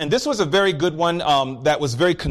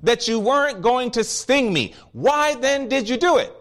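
higher-pitched anguished protest, only lightly acted; mild mock scream of pain; 8 semitones higher than usual. Stung frog confronts the scorpion that broke its promise, mock anguish. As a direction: plead in a raised pitch, short of screaming. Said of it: "The frog is screaming in pain".

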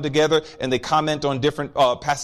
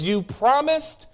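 higher-pitched anguished protest, only lightly acted; mild mock scream of pain; 8 semitones higher than usual. Stung frog confronts the scorpion that broke its promise, mock anguish. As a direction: plead in a raised pitch, short of screaming. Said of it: "The frog is screaming in pain".

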